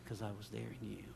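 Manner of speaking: very soft, low answer; quiet divine reply. Acted. God answering Jesus as he intercedes, hushed and low. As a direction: answer intimately, barely above a murmur. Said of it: "And he goes".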